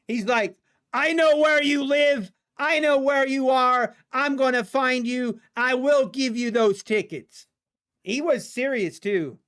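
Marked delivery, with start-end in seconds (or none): 0.9-7.5 raised-pitch angry threat, easing toward the end; imitated threat. Mad trooper to the driver who mocked his ticket, acted-out threatening. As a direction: threaten like a furious cop, retold. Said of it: "he literally threatened me".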